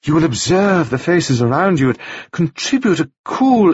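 chattering widow, pleased with herself; gushing widow. A narrator's reading. Mrs Wells directs.

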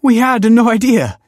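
high, loud happy gush; joyful gush. A narrator's reading. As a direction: pour out delight at the surprise. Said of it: "gushed Nina".

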